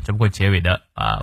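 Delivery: ordinary delivery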